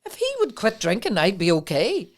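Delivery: high, loud, indignant denial; indignant protest. Retold or imitated. Woman to the counsellor, defensive high indignant cry. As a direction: protest your innocence, shocked. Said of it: "And I went".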